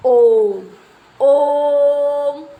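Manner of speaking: ओम sung out long and loud, like a chant; drawn-out om. Shown as a demonstration. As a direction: hold the ओम as a long nasal hum. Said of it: "उसको बहुत लंबा खींचना पड़ता है". (ओम को बहुत लंबा खींचकर)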